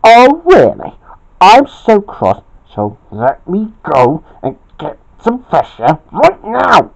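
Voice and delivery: mock scream, loud and high at both ends; cross yell. A narrator's reading. Bobby storms off for fresh air, cross yell. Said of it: "screamed Bobby".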